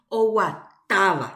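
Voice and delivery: "oguatáva" with a strong stressed TA. Shown as a demonstration. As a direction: lean hard on the TA in "oguatáva". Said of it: "A ári avei hatãve ñapronuncia". ("oguatáva" con la A de TA más fuerte)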